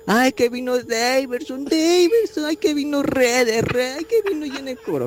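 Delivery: high, whiny mock of a hyped fan. Mocking fans who hype new players, high and whiny. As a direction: gush over the new signings in a mocking tone. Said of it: "Yo no tengo cara diciendo".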